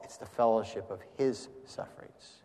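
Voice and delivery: soft delivery, "his" set apart; stress on HIS. Shown as a demonstration. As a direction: dwell on "his", quietly. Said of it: "it's the difference where you put the emphasis".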